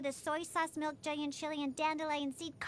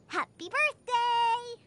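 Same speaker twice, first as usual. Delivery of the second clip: slow, higher birthday cheer; celebratory call, stretched at the end; cheery birthday wish; 6 semitones higher, 3 dB louder, slower than usual. Acted. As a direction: draw out a high "happy birthday". Birthday wish after serving food.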